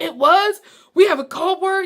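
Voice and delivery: high, loud astonished questions; genuinely floored by the news; stunned disbelief. Retold or imitated. Learns about forgotten code word.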